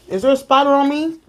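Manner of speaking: high-pitched worried question; alarmed. Real, not acted. Wife asking husband about a spider on her, alarmed. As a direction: break off, suddenly uneasy.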